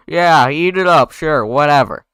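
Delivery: exasperated brush-off; irritated dismissal. Acted. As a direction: brush them off loudly. Acted irritated dismissal in script.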